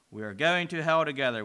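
ordinary delivery, nothing marked

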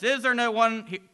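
falling man calling into the void, shouting; shouting into darkness. Acted. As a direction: cry out, searching for anyone. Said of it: "Again the man shouts into the darkness".

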